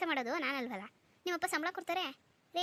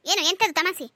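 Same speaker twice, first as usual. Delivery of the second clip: louder indignant challenge; indignant retort; 3 semitones higher, 8 dB louder than usual. Acted. Wife snaps back at her husband for calling her a joke, indignant and louder. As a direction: throw the word back at him.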